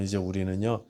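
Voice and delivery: ordinary talk, nothing marked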